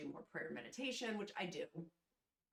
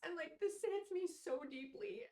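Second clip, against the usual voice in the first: high, slow, tearful voice; fighting back tears; crying; 11 semitones higher, slower than usual. Real, not acted. Telling viewers how deeply it hit her, crying. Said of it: "I hate crying on camera".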